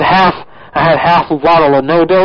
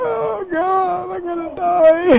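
high wailing mock panic; 14 semitones higher, 5 dB quieter, slower than usual. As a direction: wail that you're dying, hammed up. Panicked prank caller.

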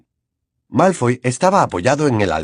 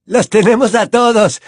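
thuggish student voice; 11 semitones higher, 7 dB louder than usual. Acted. Warrington announces.

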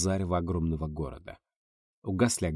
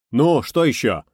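louder, higher anxious shout; 5 semitones higher, 9 dB louder, slower than usual. Acted. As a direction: call back in alarm. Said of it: "крикнул я". (встревоженный крик)

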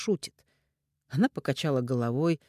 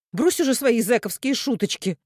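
high, loud exasperated order; irritated scolding; 8 semitones higher, 6 dB louder than usual. A narrator's reading. Mother scolds the ex-convict, irritated. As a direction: snap at him to stop joking.

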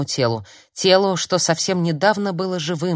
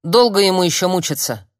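loud, raised, demanding question; barked; 4 semitones higher, 7 dB louder than usual. A narrator's reading. Friend demands an end to dying man's suffering, barking. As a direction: bark the question angrily. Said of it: "рявкнула Лисана".

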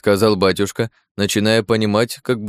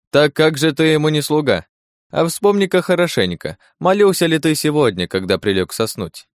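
high, needling interrogation; insistent questioning; 6 semitones higher than usual. Acted. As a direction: press the accusation in a raised pitch. Подьячий уличает батюшку. (настойчивый допрос высоким голосом)

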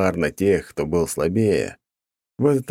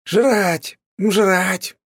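shrill, much higher bird yell, loud; bird's screeching cry; 14 semitones higher, 4 dB louder than usual. A narrator's reading. Hungry hawk shrieking for food in his master's head. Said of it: "Коршун выждал пару секунд и закричал".

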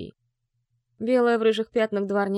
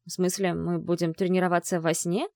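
ordinary delivery, nothing marked

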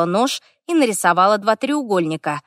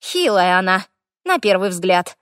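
plain reading voice

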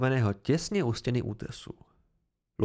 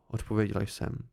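plain reading voice

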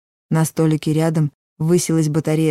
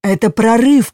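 loud alarmed shout; combat callout; 7 semitones higher, 7 dB louder than usual. A narrator's reading. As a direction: yell the alarm. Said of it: "крикнул мэтр Цельс".

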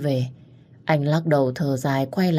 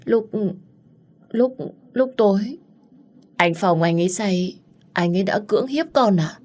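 halting, higher-pitched, faltering confession; trembling voice; 5 semitones higher than usual. A narrator's reading. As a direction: stammer the first word, then confess in a higher voice. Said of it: "giọng cô ta run rẩy". (giọng run rẩy, ngập ngừng)

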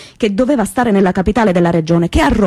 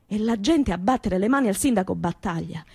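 ordinary delivery, nothing marked

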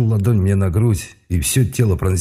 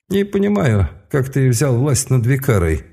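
high, cold, puzzled sorcerer; a mage wondering aloud, icy; 6 semitones higher than usual. Acted. Mage Elirah speaking.

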